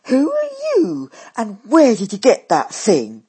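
loud, high demand from Daisy; shocked loud demand. A narrator's reading. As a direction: challenge the stranger at full volume. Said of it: "she demands".

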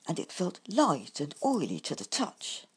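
ordinary delivery, nothing marked

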